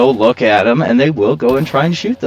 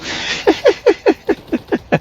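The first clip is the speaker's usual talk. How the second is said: breathy laugh. Real, not acted. Laughs at own complaining.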